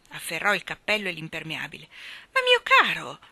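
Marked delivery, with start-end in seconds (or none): 2.3-3.2 high, loud wifely protest; alarmed, high exclamation; protesting cry. A narrator's reading. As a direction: cry out to stop him. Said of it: "gridò la signora Banks".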